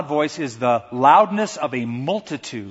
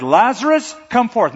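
higher, louder commanding call; a public, ringing order; 8 semitones higher, 5 dB louder than usual. Acted. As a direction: summon him out of the tomb. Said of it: "he shouted".

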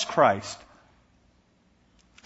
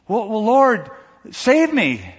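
higher, louder begging; pleading; 7 semitones higher, 4 dB louder, slower than usual. Acted. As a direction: beg the Lord to save you. Man pleads with God.

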